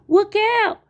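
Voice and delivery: high, loud cartoon warning; warning shout. A narrator's reading. Tweety warning Sylvester of the pond, high shout. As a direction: yell it high and sudden. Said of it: "shouted Tweety".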